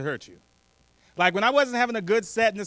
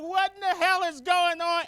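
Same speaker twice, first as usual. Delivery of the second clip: retold heckler scream; 10 semitones higher than usual. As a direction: replay the lady's outcry in a raised pitch. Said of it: "Like, she screamed out".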